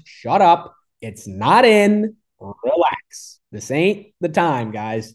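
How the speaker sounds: high, drawn-out mock scolding; exasperated. Golfer telling the guys to relax, exasperated. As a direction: shut them down, stretching the words.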